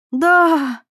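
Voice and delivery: high, loud, elated shout; joyful cry. A narrator's reading. Irina hearing cars at last, joyful cry. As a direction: burst out with joy. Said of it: "радостно закричала она".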